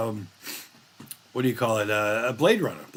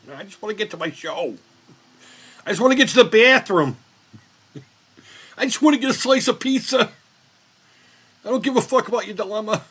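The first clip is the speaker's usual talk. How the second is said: exasperated; 11 semitones higher, 7 dB louder than usual. Retold or imitated. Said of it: "his reply to protester".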